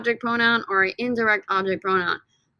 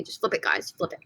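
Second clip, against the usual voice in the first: 1 whispered aside; 5 semitones lower, 7 dB quieter, faster than usual. Real, not acted. Aside to students.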